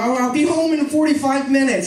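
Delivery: put-on old man talking too loudly on his phone; loud phone talker. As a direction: announce it loudly to the wife.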